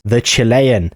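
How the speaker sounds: normal talking voice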